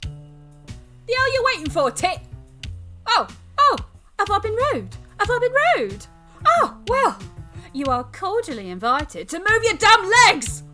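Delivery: loud, high, furious shouting; exasperated full-force outburst; biting. Acted. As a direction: yell at him with mock courtesy and bite. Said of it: "with a little bite".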